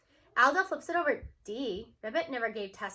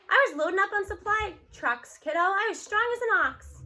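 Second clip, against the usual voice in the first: put-on higher, louder proud old grandma; Grams boasting; 6 semitones higher, 5 dB louder than usual. Grams brags to her grandkid, higher and louder.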